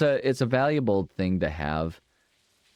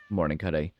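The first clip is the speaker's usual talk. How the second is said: ordinary talk, nothing marked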